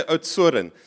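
Oudtshoorn the Afrikaans way, ending "-oo-ren"; local pronunciation. Retold or imitated. Son telling dad how to say it, Afrikaans-style Oudtshoorn. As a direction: say Oudtshoorn with a tapped r and an extra last syllable. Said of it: "So you gotta say".

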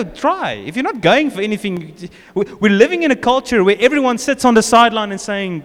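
ordinary talk

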